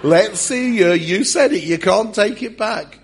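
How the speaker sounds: high, loud teasing jeers; mock goading. Other disciples to Peter who opened his mouth, loud goading. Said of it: "imagine the other disciples saying to Peter".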